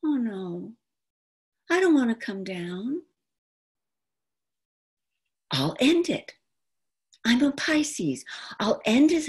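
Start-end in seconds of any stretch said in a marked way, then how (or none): none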